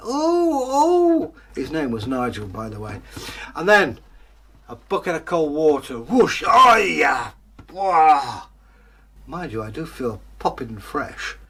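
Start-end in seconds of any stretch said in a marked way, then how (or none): 0.1-1.3 high, loud yelping ooh; mock screaming. Tramp scrubbed with a brush, yelping high and loud. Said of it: "he was yelling and screaming". 6.0-8.5 loud, slow, high shocked yell; cold-water shout. Acted. Tramp doused with cold water, shouting in shock.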